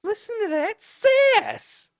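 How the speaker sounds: high, slow, squealed mock outrage; a high, drawn-out shriek of mock disgust; squealing mock outrage. Real, not acted. Mocking Ray's sassy reply.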